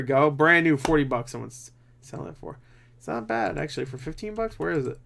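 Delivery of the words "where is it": usual speaking voice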